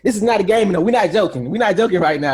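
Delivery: loud, emphatic insistence; mock serious insistence. Showing someone how serious they are, emphatic. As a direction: drive each short phrase home loudly. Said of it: "I want you to know how serious I am".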